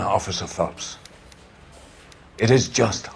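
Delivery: usual speaking voice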